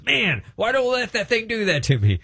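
high, astonished voice; amazed wondering. Retold or imitated. Freed man wondering why he put up with it, high and amazed. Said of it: "He goes".

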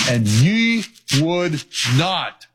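ye would not, each word slowed and set apart; slow weighted phrase. Acted. Preacher quoting Jesus, ye would not drawn out slowly. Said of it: "Those three words".

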